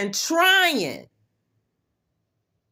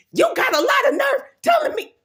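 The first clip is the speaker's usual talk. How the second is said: mock indignant woman; 13 semitones higher, faster than usual. Quoting a woman who scolds her, in a high indignant shout. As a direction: squeal it like an offended lady. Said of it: "That's what she said".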